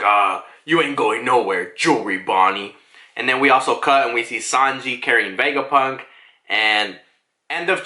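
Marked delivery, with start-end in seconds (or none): 0.0-2.7 menacing admiral. Retold or imitated. Menacing vice admiral cracking knuckles.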